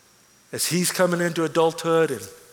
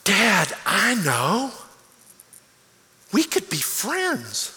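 put-on excited little boy; 2 semitones higher, much slower than usual. Little son telling his dad they could be friends, eager. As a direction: deliver it like a child's big discovery.

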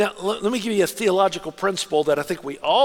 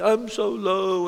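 slow, mock self-pitying; imitating a falsely humble believer, slow; mock humility; much slower than usual. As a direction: drag it out like false modesty. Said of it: "kinda like this humility".

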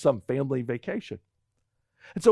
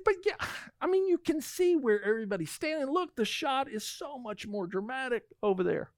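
much higher, eager and wheedling; pleading; 7 semitones higher, 7 dB quieter than usual. Retold or imitated. Husband pleading with wife for the dramatic shot, higher. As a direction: talk her into it, voice up high.

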